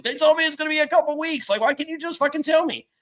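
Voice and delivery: high, fast, put-on whine; mock whiny outburst at the doctors; whiny frustrated rant. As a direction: complain like an exasperated kid. Retold frustrated inner rant.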